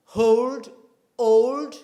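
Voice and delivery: put-on "Hold", потом "old" громко, без h; dropping the H. Shown as a demonstration. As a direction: say "Hold", then "old" loudly with the h cut. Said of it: "Leave out the H sound".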